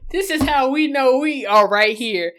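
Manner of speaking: loud, laughing through the words; bursting with laughter as she tells her. Real, not acted. Friend laughing loudly while making her point.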